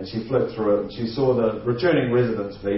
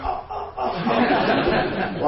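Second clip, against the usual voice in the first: repeated little high gasps, played for laughs; imitated kids' gasps; 8 semitones higher, much slower than usual. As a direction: gasp like an awestruck kid. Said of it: "every kid's going".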